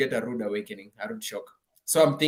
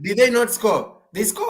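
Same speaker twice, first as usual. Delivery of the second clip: high-pitched, emphatic rebuttal; insistent challenge; 8 semitones higher, 5 dB louder than usual. Real, not acted. Host challenging a fan on whether they scored, insistent. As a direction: press the point, pitch jumping up. Heated debate over a match.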